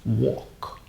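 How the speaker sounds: "wok" said softly with a silent L; "walk" said like wok, no L sound; teacher modeling walk as wok; model pronunciation. Shown as a demonstration.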